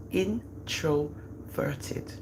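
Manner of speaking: Introverted stretched very slowly and softly; drawn-out word. Shown as a demonstration. Mother stressing her son's shyness, stretching Introverted out slowly. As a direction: draw out Introverted syllable by syllable, hushed.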